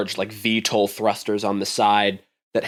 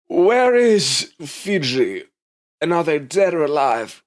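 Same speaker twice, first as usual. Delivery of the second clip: higher, very slow, drawn-out question; executive woman; 6 semitones higher, 4 dB louder, slower than usual. Acted. Executive questioning survivors about Fiji, slow and high. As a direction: press them for an answer, stretching out where.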